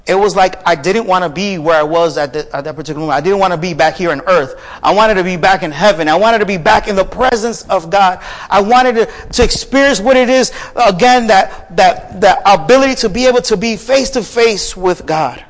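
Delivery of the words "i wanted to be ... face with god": building to a higher, urgent pitch; fervent preaching. Real, not acted. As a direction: preach the longing with rising force. Preaches with rising fervour.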